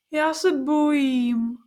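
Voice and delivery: put-on slow, frightened little girl's whine; a scared child clinging to grandma; girl tells her grandmother she is scared, slow whimper. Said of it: "zakňuralo děvčátko".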